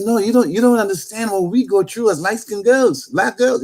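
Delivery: lightly voiced woman pressing her point; retold insistent woman.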